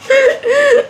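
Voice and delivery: high-pitched burst of laughter; laughing. Real, not acted. Storyteller cracking up over the police call, high laugh. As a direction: crack up loudly at your own outrage. Laughs at her own outrage.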